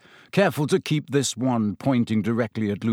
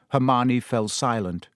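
usual speaking voice

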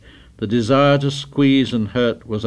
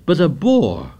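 higher-pitched protest; voice running up; 6 semitones higher than usual. A narrator's reading. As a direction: let the pitch climb in protest. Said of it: "Ralph's voice ran up".

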